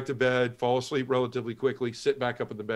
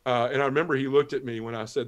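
normal talking voice

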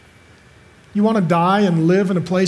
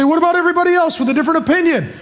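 quick mock objector; 10 semitones higher, much faster than usual. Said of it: "You say".

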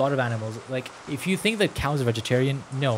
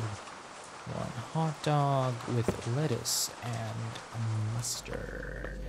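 very slow, softer, drawn-out words; slow self-reminder; 7 dB quieter, much slower than usual. Real, not acted. Cashier memorizing a hot dog order, slowly drawn out. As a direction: stretch each word out slowly and quietly. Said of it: "I keep telling that to myself".

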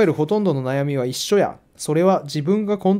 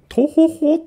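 mock dejected sigh; 11 semitones higher, 4 dB louder, much slower than usual. Mocks the author's sense.

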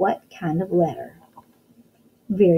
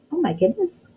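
mock surprise; 8 semitones higher than usual.

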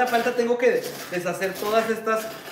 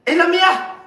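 high, loud excited cry; excited shout; 9 semitones higher, 6 dB louder than usual. Real, not acted. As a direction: shout it out, thrilled. Excited cry over bracelet.

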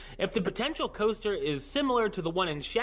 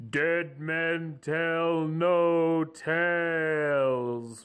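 spooky movie title voice; much slower than usual. Acted.

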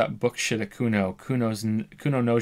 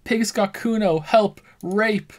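higher, loud fake cries for help; kid shouting for help; 10 semitones higher, 4 dB louder, slower than usual. The kid yelling up at windows, mock screams for help. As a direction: yell to the windows like a kid faking danger. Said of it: "as the child shouts at the windows".

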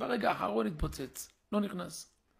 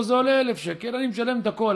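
louder, higher, eager; enthusiastic declaration; 6 semitones higher, 10 dB louder than usual. Retold or imitated. Son tells the avrech he pays it all, eager. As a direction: announce it with zeal.

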